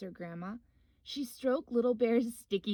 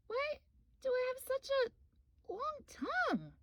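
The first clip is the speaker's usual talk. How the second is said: much higher small cub voice; little bear voice. Acted. Little Bear asking Grandma, high curious cub voice. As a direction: ask it like a wide-eyed little cub. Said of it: "asked Little Bear suddenly".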